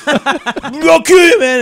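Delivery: heckler giving in. Retold or imitated. Heckler voiced in story.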